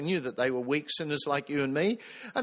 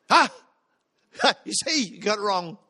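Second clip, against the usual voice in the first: gloating gotcha; 3 semitones higher, 6 dB louder than usual. Retold or imitated. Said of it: "anti them, we're just waiting".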